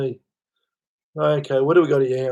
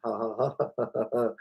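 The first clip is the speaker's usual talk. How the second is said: soft chuckle; 3 semitones lower, 8 dB quieter, faster than usual. Real, not acted. Laughs at his own tale.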